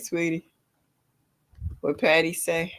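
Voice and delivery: ordinary delivery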